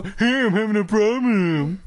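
high, whiny copycat echo; mocking a customer. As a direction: parrot a caller with slurred words. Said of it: "do customer support and be like".

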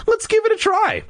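very high, eager appeal; urging. A narrator's reading. Child urging the family to try, eager. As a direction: coax them in a high, keen voice. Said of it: "I urged them".

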